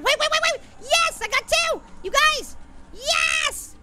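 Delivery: high-pitched, triumphant cheering; really excited. Real, not acted. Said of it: "I sound really excited about that".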